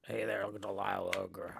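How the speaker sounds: ordinary delivery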